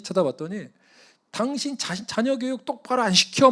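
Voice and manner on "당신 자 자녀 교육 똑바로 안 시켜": high-pitched, loud retold rebuke; angry scolding. Car owner scolding a father over his child, angry. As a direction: lay into a careless parent. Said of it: "막 화를 내는 거예요".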